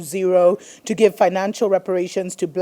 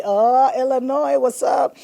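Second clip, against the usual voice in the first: playful shout-out; 6 semitones higher, 4 dB louder than usual. Acted. Host hailing Illinois mid-report, playfully excited. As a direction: hail the state like an old pal.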